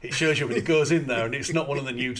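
ordinary talk

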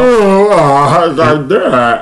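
loud, high, drawn-out cry; mock wail. Acting out seeing own lazy eye in pictures, mock wail. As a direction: stretch it into a hammed-up wail.

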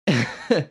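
short burst of real laughter; laughing. Real, not acted. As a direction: laugh out loud.

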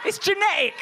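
high emphatic repeat. Real, not acted. Comic insisting a point, high emphatic. It's genetic.

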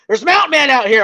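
high, loud alarmed cry; mock panic. Spooked friend warning about mountain men, loud panicked voice.

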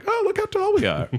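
squeaky high mock hobbit voice; mock tiny hobbit. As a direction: play a proud little hobbit, very high. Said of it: "this visual representation of".

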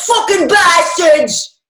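put-on high, loud drunken yell. A narrator's reading. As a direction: bellow it at the guards. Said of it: "a yell of".

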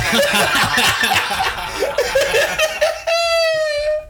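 loud high shrieking laugh; howling laugh. Real, not acted. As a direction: burst into a huge cackle. Bursts out laughing at story.

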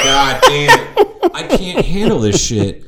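high, loud cracking-up protest; laughing exasperation. Retold or imitated. Host retelling the reaction in the theater, exasperated laughing. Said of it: "And I'm like".

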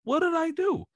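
very high, rising feigned innocence; mock innocent whine. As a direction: squeal it like a wronged trainer. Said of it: "he's just like".

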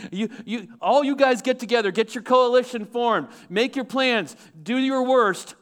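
taunting God. Acted. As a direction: dare them to try, voiced as God. Voicing God taunting the nations.

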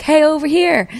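high, eager showman call; Steve Martin bit. Retold or imitated. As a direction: call out to the spotlight. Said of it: "the Steve Martin, the light joke".

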